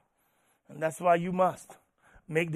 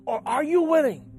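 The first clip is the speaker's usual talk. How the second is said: raised, insistent sermon voice; challenging preacher; 6 semitones higher, 9 dB louder than usual. Real, not acted. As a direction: build to a forceful challenge.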